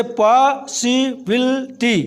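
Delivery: पॉसिबिलिटी slowly, in separated chunks; split into syllables. Shown as a demonstration. Teacher giving a long-word example, पॉसिबिलिटी broken into syllables. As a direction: sound out पॉसिबिलिटी piece by piece. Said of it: "इसमें पांच syllable थे".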